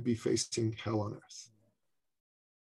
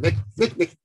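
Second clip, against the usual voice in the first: high, raised repeated name; urgent calling; 7 semitones higher, 5 dB louder than usual. Retold or imitated. Man comes up to the host, calling urgently. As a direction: imitate someone insistently getting attention.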